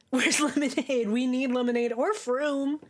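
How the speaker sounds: a hint of a whiny kid calling; mock demanding voice. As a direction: shout for the lemonade like a bratty kid. Playful demand for drink.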